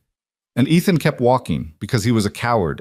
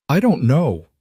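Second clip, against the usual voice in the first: raw outburst; 6 semitones higher than usual. Acted. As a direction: let the words burst out, raw and honest. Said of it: "The words burst out of him, raw and honest".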